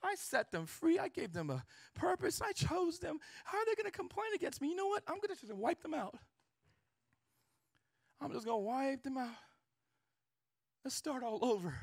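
high, exasperated voice of God complaining; God venting to Moses about his people; God frustrated. Retold or imitated. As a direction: vent like a fed-up father. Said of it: "He's like".

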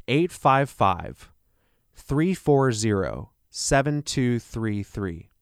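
slow, evenly spaced digits. Real, not acted. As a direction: read the number out slowly, group by group. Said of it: "I'll say that a little slower".

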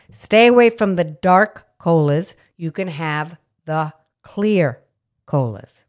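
ordinary talk

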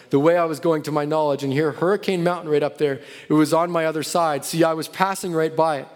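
ordinary delivery

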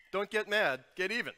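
the idiom Don't get mad, get even, punched high and loud; punchy idiom. Shown as a demonstration. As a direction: hit it hard like a slogan. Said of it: "Kinda like this".

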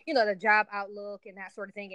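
ordinary talk

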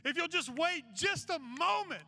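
high, driving sermon delivery; impassioned preaching. Real, not acted. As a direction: preach it with rising urgency, leaning on key phrases. Preaches the story's lesson at full pitch.

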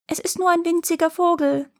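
high, tiny bird-like squeak; fine little voice. A narrator's reading. Boy answering the roaring giant, fine-voiced. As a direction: answer in a thin high pipe. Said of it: "antwortete der Junge mit feiner Stimme".

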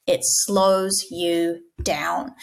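very slow, hushed and drawn out; slowed right down. Shown as a demonstration. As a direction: stretch every word to show slowing. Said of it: "demonstrates slowing down".

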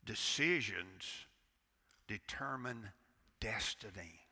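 low, soft, slow "decisions determine destiny"; preacher drives home the key line; slow emphatic. Real, not acted. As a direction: space each word out weightily.

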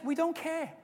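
ordinary delivery

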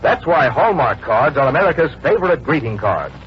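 normal talking voice

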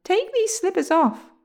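high-pitched, desperate plea; pleading cry. A narrator's reading. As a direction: beg for help in a high voice. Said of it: "he cried".

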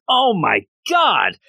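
high, slow aghast gasp; hammed-up dismay; horrified oh my God. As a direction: drag it out in mock horror. Finding half a body.

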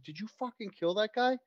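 high, sped-up panicked exchange; mock panic. Killers panicking that everybody knows, mock panic. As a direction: play rattled culprits, high and rushed.

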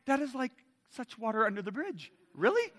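high-pitched retold disbelief; incredulous. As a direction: protest it, jumping up high on the last word. Retold disbelief at wife's lasting anger.

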